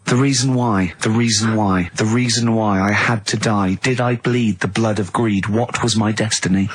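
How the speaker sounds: mock-solemn reading of a bad schoolboy poem; solemn poem recital. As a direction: recite it like a grave teenage poet. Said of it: "this is a poem".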